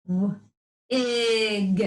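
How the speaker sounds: "W-ig" split into two parts; segmented word. Shown as a demonstration. Teacher segmenting the word on the coaster. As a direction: break "W-ig" into onset and rhyme. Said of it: "Hop on your coaster".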